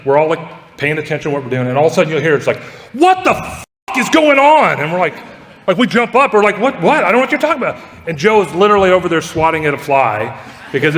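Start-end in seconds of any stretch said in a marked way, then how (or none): 2.9-4.8 imitated high-pitched office outburst; mock outraged yell. Joe erupts at his desk over a fly, mock outraged yell. Acts out coworker's sudden outburst at work. 6.6-7.8 high-pitched mock panic; startled bewilderment. Coworkers jump up at Joe's outburst, high mock bewilderment.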